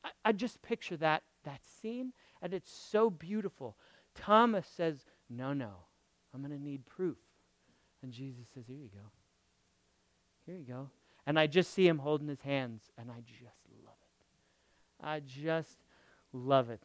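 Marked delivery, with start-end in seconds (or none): 5.3-10.9 hushed tender exchange. Retold or imitated.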